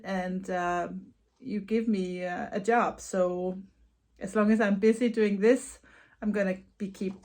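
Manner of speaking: ordinary talk, nothing marked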